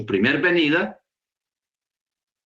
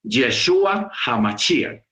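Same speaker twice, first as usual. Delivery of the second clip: "Yeshua HaMashiach" said slowly, higher, every syllable weighed; slow emphasis; 6 semitones higher, slower than usual. Shown as a demonstration. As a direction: stress "Yeshua HaMashiach" as the original name. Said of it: "tal cual como está en la Escritura".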